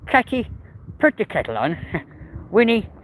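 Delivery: comic impression, pitch jumping high then dropping; Missus Brown. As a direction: do a playful TV-character impression, half laughing. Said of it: "Could do Missus Brown for you".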